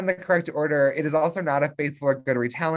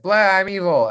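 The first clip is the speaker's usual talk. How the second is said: hammed-up villain, louder and higher; mock dark-side declaration; mock evil Anakin; 6 semitones higher, 6 dB louder than usual. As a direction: sneer it like a cartoon bad guy.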